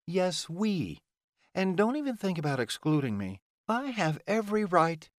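high-pitched indignant protest; insistent Delilah. Acted.

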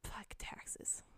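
low, unvoiced angry whisper; whispered cursing. Real, not acted. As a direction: hiss the hate under breath. Venting in whisper.

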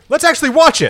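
high, bright push to watch it together; squeaky eager pitch; excited. Pretend. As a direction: pitch it up like a sudden great idea.

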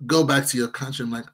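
ordinary talk, nothing marked

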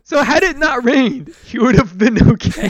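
amused, giggling it out; laughing. Real, not acted. Laughing at absurd death.

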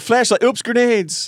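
high, flustered mock panic. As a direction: fumble it out, flustered. Mocking panicked gamer.

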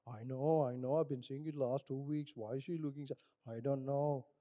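low-voiced mock gossip; gossiping church member. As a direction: play a knowing gossip, pitched well down.

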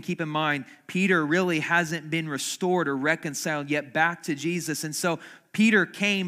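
loud, high, driving sermon voice; preaching at full force. Acted. Pastor stirs the congregation about Peter, preaching loudly.

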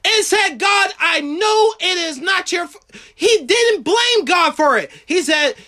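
high, emphatic sermon cry; impassioned preaching. Real, not acted. Preacher insists Job never blamed God, impassioned preaching. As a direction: drive each point home at a high pitch.

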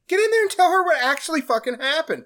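high, pushy hype-man urging; urging friends. Retold or imitated. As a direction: push him in like an eager buddy. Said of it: "Kumail and Stupid Guy are like".

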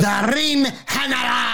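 high, slow shouted ring-announcer call; announcer intro. Pretend. Cohost announces the wrestler's nickname like a ring announcer.

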